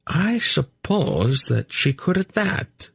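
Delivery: high, slow, bland agreement. A narrator's reading. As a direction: agree blandly, taking your time. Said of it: "Varana agreed blandly".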